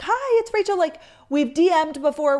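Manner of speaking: high, chirpy imitation of an excited fan; bubbly fan greeting. Fan introducing herself to the creator, high and chirpy. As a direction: play the gushing fan saying hi.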